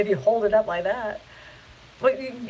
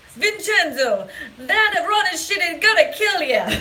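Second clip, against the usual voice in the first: hammed-up Italian accent, higher-pitched; crappy Italian accent; 6 semitones higher, 3 dB louder than usual. Imitating grandfather warning dad Vincenzo, crappy Italian accent. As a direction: do a bad Italian-grandfather accent, sing-song. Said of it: "That's my crappy Italian accent".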